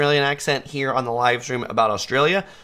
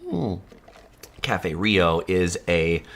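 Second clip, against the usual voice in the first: ordinary talk, nothing marked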